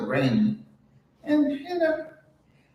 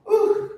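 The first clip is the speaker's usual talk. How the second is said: a sudden high ooh of strain; 4 dB louder than usual. Real, not acted. Mid-stretch, she gives a high ooh of effort.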